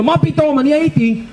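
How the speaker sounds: high-pitched mock protest; indignant soldier. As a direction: argue back like an affronted private. Soldier arguing back at officer.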